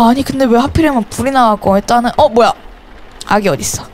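normal talking voice

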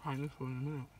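speaking between bites; talking while chewing. Real, not acted. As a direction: mouth full of food. Seen 1: Cheeks puffed and jaw working while talking, chopsticks in hand.

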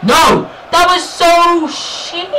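high, loud, slow wail; drawn-out cry of frustration; anguished yell. Real, not acted. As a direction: wail over the missed chance. Cursing own miss.

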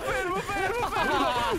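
high, loud repeated stop; panicked yell. Real, not acted. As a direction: scream for him to stop. Panicked yelling at an attacker. (urlo acuto di panico)

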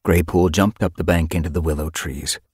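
usual speaking voice

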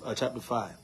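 ordinary talk

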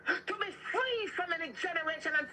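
high, insistent, repetitive prayer cadence; fervent deliverance prayer. Real, not acted. Preacher praying listeners free from bondage, fervent deliverance. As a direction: push each plea out with urgency.

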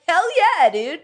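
high, loud, mock-hyped yell; imitating Rome. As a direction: ham up a gung-ho bro. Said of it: "be like".

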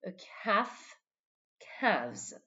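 calf and calves said with no L; silent L in calf, calves. Shown as a demonstration. Teacher showing learners calf and calves with a silent L. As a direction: drop the L in calf, then in calves. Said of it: "le L ne se prononce pas".

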